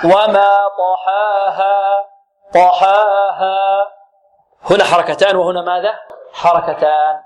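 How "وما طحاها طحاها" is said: slow recited "وما طحاها", both alifs two counts; correct equal madd. Shown as a demonstration. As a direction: hold the two long vowels of "طحاها" evenly. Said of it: "الصحيح ماذا؟".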